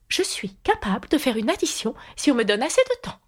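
a light touch of a high-voiced queen. A narrator's reading.

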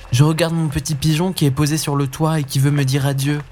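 put-on a boy making an innocent excuse; innocent boy Hansel.

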